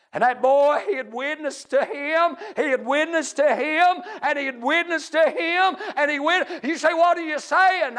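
high, loud revival preaching; full-force preaching. Real, not acted. Preacher telling church he joined the deacon's praise, full-force preaching. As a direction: preach it out in rising excitement.

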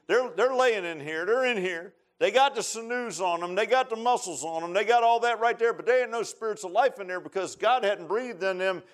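full-force sermon delivery; fervent preaching. Real, not acted. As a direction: preach it high and urgent, line upon line.

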